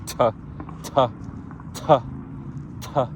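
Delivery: "t" said crisp with no puff of air; unaspirated t. Shown as a demonstration. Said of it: "But in English, we just have t".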